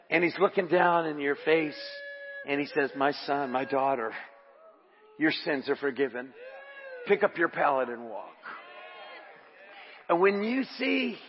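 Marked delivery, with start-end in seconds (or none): 2.9-8.4 low and soft, gently spoken; quiet fatherly tenderness; tender, quiet forgiveness. Retold or imitated. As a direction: say it softly, as if face to face. Jesus speaks to the listener.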